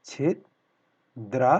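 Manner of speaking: छिद्रा broken into slow, separated syllables; drawn out in parts. Shown as a demonstration. Teacher showing students how to write it, slow syllables. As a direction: spell out छिद्रा slowly, chunk by chunk. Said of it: "कैसे लिखते हैं".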